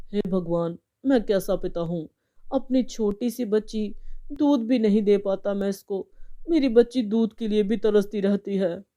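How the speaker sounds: father's sorrowful lament to himself; grieving father. Acted. Father brooding over his sleeping child, sorrowful. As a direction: reproach yourself sadly as a helpless father. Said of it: "Rajeshwar बहुत दुखी हो गया था".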